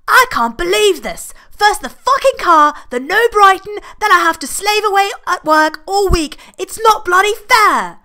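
high, loud raging rant; a roaring tantrum from the bathroom; furious roar. Acted. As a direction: explode with fury at everything going wrong. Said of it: "Roger's roar makes the walls vibrate".

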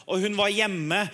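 ordinary delivery

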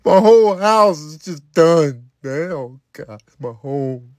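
ordinary delivery

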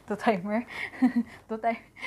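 giggling at a slip of the tongue; laughing. Real, not acted. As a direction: break up laughing mid-word. Cracks up at own word choice.

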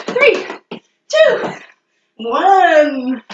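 high, rising countdown call; hyped countdown. Real, not acted. Coach counts down the last seconds for her class, high and pumped. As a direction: count them down with energy.

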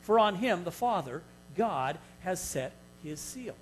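ordinary talk, nothing marked

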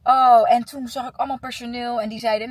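ordinary delivery

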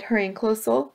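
usual speaking voice

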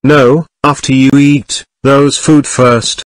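sudden loud shout, pitch steady; yelling the order across the table; shouted refusal. Acted. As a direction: bark the no at a greedy customer. Owner refusing the cookies.

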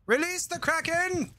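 high, drawn-out mock rallying call; hammed-up battle cry. Gamer playfully commanding a monster, hammed-up battle cry. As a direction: stretch it out high like a grand command.

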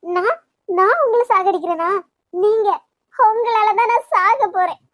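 shouting back in hurt fury; furious shout. Acted. Heated lovers' quarrel.

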